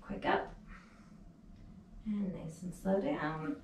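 strained whisper during sit-ups; breathless whisper mid rep; doing sit-ups. Real, not acted.

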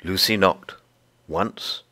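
ordinary delivery

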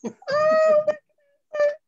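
very high, drawn-out cooing; delighted squeal. Real, not acted. Crafter gushing over tiny cute bees, delighted squeal.

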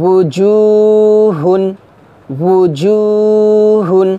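উজুহুন chanted very slowly, each sound held; drawn-out recitation. Shown as a demonstration. Teacher chanting উজুহুন for students to repeat. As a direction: draw out উজুহুন in a slow Quranic chant. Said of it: "আবার আমার সাথে বলেন".

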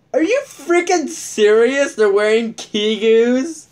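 high, stretched incredulous questions; amazed disbelief. Real, not acted. Seeing people in Kigus, amazed. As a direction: gasp it out in disbelief.

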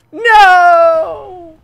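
very loud high shriek into a laugh. Real, not acted. Streamer missing the last kill, shriek then laugh. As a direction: scream no then crack up.

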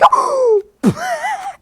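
sharp high gasp; high startled gasp. Real, not acted. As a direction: gasp in shock. Shocked in fight.

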